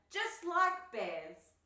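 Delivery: loud, high, stretched-out call; boisterous storybook yell; excited shout. A narrator's reading. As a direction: holler it with playful gusto. Friends' shouted boast continues.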